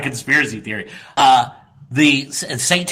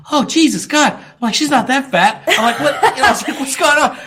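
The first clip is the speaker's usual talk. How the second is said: high-pitched retold dismay; exasperated disbelief; 9 semitones higher than usual. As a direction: replay your bewildered protest, pitched up. Acts out shocked reaction to diagnosis.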